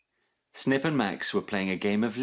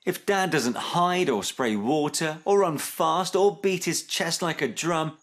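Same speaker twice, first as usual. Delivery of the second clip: higher, pleading list; crying out; 8 semitones higher, slower than usual. A narrator's reading. Snip questioning mum about dad, crying out. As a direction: reel it off in mild exasperation. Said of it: "cried Snip".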